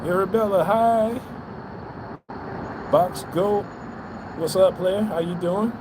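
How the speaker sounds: usual speaking voice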